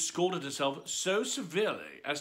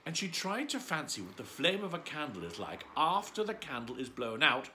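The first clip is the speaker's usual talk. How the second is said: ordinary delivery, nothing marked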